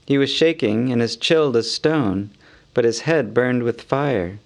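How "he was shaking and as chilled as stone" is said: ordinary delivery, nothing marked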